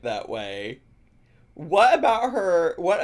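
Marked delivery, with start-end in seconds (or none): 1.5-3.0 incredulous. Real, not acted. Incredulous outburst at pronunciation.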